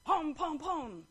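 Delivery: booming playful "pom" steps; stomping noises. Acted. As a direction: stomp along with vocal thuds. Lion stomps up to the elephant.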